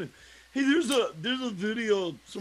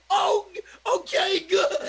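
much higher, louder mock-delighted reply; mock relief; 13 semitones higher, 4 dB louder, slower than usual. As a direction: shout it high, hamming up the relief. Acted reply retelling own joke.

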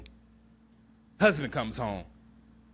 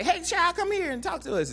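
much higher, called-out flirty hail; flirty best friend calling out. Retold or imitated. Best friend yelling over to the lawnmower man, high flirty call. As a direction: yell sweetly across the yard in a high sassy voice. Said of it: "She say".

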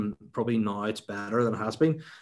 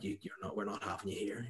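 muttered dismissal; 12 dB quieter than usual. Retold or imitated. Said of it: "some of them were like".

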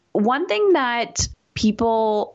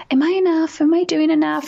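higher and louder, pleading worry; anxious plea; 9 semitones higher, 4 dB louder than usual. Retold or imitated. Asking her husband during launch if she's enough, anxious plea. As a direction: ask it like you're about to cry. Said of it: "And then I cry".

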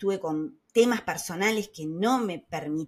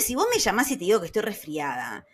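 ordinary talk

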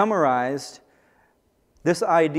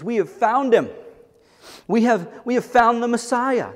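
higher and louder, bursting with discovery; excited announcement; 8 semitones higher, 5 dB louder than usual. Acted. Disciple telling his brother the Messiah is found, overjoyed. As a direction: shout the news like you just found it.

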